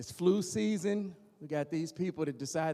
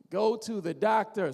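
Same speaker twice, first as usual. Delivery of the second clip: ordinary talk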